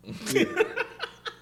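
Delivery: high, giggly burst of laughter; helpless laugh. Real, not acted. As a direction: crack up at your own story. Cracks up at own story.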